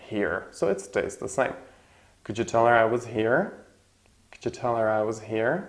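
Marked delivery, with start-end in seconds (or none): 2.2-3.4 fast full sentence. Shown as a demonstration. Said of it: "the last word is prominent".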